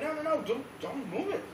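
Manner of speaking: lightly acted crowd alarm; alarmed pleading. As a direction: beg them to stop, playfully panicked. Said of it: "they were like".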